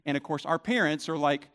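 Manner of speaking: ordinary delivery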